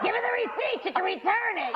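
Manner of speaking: high-pitched imitation of yelling relatives; mock yelling. Family at a gift opening, high mock yelling. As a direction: holler it way up high. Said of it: "they're yelling, they're like".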